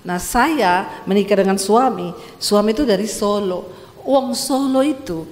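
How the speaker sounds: normal talking voice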